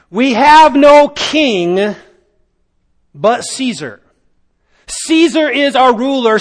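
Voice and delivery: preacher voicing the crowd rejecting Jesus; crowd demanding. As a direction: shout it like the crowd choosing Caesar. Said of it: "they are demanding".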